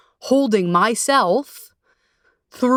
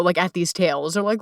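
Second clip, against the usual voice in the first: ordinary delivery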